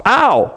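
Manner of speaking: a sudden high yelp of pain; like someone stung by a wasp, a sharp little cry. A narrator's reading. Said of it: "Lucy gave a sharp little cry".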